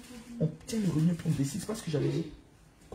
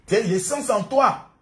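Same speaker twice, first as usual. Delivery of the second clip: loud, high, booming mock deliverance preacher; mock exorcism; 10 semitones higher, 11 dB louder, slower than usual. Father playfully casting a party spirit out of his child, mock exorcism. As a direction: draw it out, building to a chanted shout.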